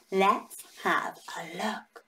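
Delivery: slow hushed whisper; whispered peek into the bag. Acted. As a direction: breathe it, building suspense. Peeking into the sack.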